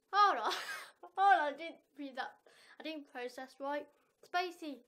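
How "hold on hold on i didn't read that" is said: startled laughing. Real, not acted. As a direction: burst out laughing, loud protest. Laughs at rude line just read.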